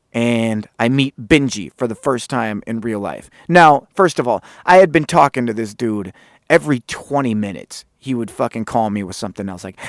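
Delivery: usual speaking voice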